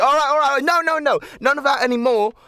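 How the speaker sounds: high, loud firm protest; fending off kids. Acted. Snow shrugging off the wrestling children, high and loud. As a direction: push them off while laughing them down.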